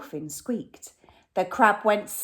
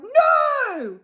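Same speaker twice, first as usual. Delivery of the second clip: much higher, loud yell; 8 dB louder than usual. Acted. Len pinched by the crab, loud high yell. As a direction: yell in alarm. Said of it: "and Len yelled".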